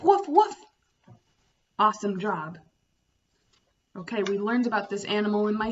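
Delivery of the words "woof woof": high, loud puppy woofs; bright playful barking for kids; dog barking. Shown as a demonstration. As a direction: yap it out like a happy dog. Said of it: "A dog goes woof".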